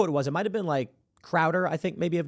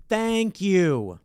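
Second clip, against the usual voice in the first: sarcastic thanks; 3 semitones higher than usual. Retold or imitated. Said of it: "And I'm like".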